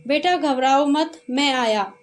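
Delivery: mildly voiced challenge; calling out. A narrator's reading. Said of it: "ललकार कर कहा".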